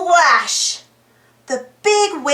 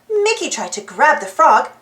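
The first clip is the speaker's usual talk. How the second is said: plain reading voice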